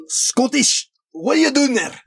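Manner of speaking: high mock Scottish accent. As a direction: ham up a Scottish bear owner. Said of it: "you could be like".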